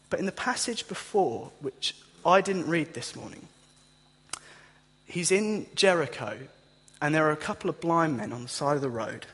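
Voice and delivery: ordinary talk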